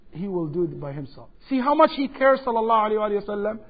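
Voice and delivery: ordinary talk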